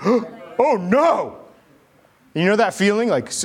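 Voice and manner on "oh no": mock alarm. Class reacts to disruptive kid.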